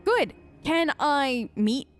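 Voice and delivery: usual speaking voice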